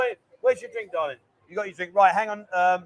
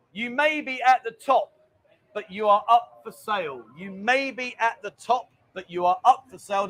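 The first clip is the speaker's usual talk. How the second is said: slow, deliberate riddle reading; slow quiz read. Shown as a demonstration. Host reads quiz clue to viewers, slow and deliberate. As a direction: spell the clue out slowly for the viewers. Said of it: "question number one".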